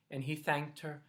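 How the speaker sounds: usual speaking voice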